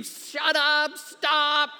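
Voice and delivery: very high imitated scream; loud mock shriek, imitating an upset woman. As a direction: scream over someone's singing. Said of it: "who continued to scream".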